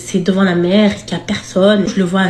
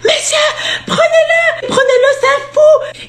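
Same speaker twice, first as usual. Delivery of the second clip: very high laughing mock scream; retold panicked scream, much higher and laughing; yelling like a madwoman; slower than usual. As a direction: beg the police, laughing. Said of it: "gueuler comme une folle".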